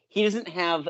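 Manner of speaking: usual speaking voice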